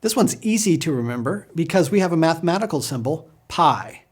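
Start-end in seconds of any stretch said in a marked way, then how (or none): 3.5-4.0 "pi" as "pie", long i; English math pi. Shown as a demonstration. Teacher cites the mathematical symbol, pronounced pie. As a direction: say "pi" rhyming with eye. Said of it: "don't pronounce it as pi".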